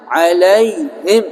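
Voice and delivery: "alaihim" with a full breathed h before -im; correct big H. Shown as a demonstration. Teacher showing how it should be, clear h. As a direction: sound the h firmly in "alaihim", alai-HIM. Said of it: "harusnya alaihim".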